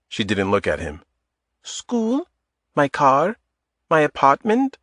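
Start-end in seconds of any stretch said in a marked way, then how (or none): 1.6-4.8 put-on guarded, higher voice with a light Eastern European accent; tense, light Eastern European accent. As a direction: Adita stiffening at his request, light Eastern European accent. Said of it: "In a light Eastern European accent".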